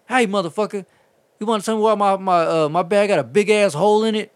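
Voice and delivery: loud, high, indignant phone tirade; irate customer. Retold or imitated. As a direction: imitate a furious caller tearing into the driver. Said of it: "was not fucking happy about it".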